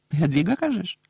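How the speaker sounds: usual speaking voice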